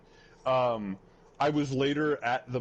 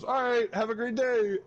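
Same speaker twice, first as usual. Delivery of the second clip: much higher, louder sing-song farewell; a VTuber chirping goodbye, pitched way up; cheery goodbye; 10 semitones higher, 3 dB louder than usual. Retold or imitated. VTuber saying goodbye to fleeing girl, high and chirpy.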